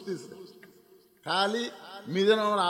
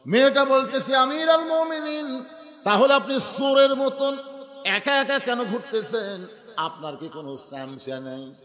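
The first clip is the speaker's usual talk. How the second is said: impassioned sermon delivery; 5 semitones higher, 5 dB louder than usual. Acted. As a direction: chant the reproach with stretched endings. Preacher dramatizes girl's question.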